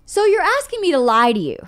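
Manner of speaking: retold incredulous pushback; indignant protest. Retold argument about false apologies.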